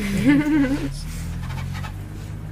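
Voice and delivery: high bursting laugh. Real, not acted. Remembering sleeping beside his father, real laugh. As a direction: laugh out at the memory. Fond memory of sleeping like sardines.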